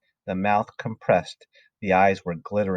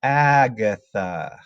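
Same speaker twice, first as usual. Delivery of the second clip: slow, drawn-out name; ghostly message; 3 dB louder, much slower than usual. A narrator's reading. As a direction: intone the name eerily, stretching it out. Ghostly chalk writes a name.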